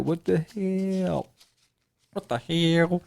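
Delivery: drawn-out, incredulous question; mock disbelief. Detective reacting to partner's promotion, imitated disbelief. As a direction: stretch out the last word in mock disbelief.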